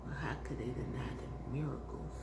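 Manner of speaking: hushed, awed whisper; whispered wonder about the miracles. Real, not acted. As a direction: ask it under your breath. Wondering to himself about Jesus.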